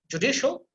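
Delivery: "judicial" with the second syllable DI emphasized; stress on DI. Shown as a demonstration. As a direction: lean on DI in "judicial". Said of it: "you have to emphasize there".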